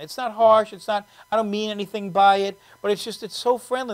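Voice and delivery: ordinary talk, nothing marked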